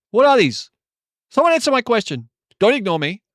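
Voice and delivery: mock-whiny insistence. Real, not acted. Demanding chat answer.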